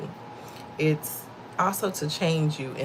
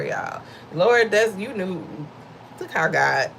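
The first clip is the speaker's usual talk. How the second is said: ordinary delivery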